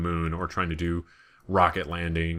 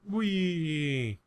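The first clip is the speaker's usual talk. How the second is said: a high, gleeful whee; playful whee; 10 semitones higher than usual. Pretend. Gamer voicing his astronaut's jump, gleeful whee. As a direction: voice the little astronaut's joy. Kerbal jumps to plant flag.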